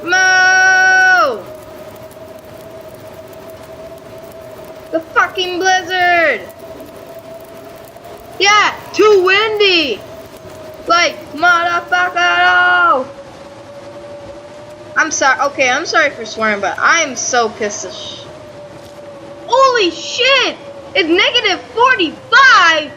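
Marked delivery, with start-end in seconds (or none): 0.0-13.1 furious yelling. Real, not acted. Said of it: "Damn, I got pissed off today". 19.5-22.9 shocked exclaim. Real, not acted. Shocked by freezing temperature.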